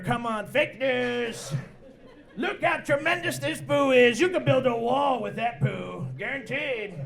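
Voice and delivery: slow, boastful Trump voice; Donald Trump impression. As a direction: sell it like Trump at a rally. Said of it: "like a Donald Trump".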